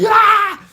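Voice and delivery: high, loud mock scream; hammed-up yelp of a whipped kid; screaming in pain. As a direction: let out a piercing comic scream. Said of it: "you're just like".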